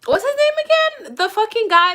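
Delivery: real high-pitched plea for a forgotten name; squeaky, frustrated question to yourself; exasperated recall. Real, not acted. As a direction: voice shoots way up, trying to remember.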